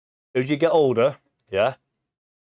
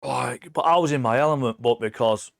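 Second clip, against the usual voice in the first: ordinary talk, nothing marked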